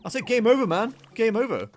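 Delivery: loud, high-pitched mock panic; mock despair outburst. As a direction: cry it out in playful despair. Mock despair, quoting game over.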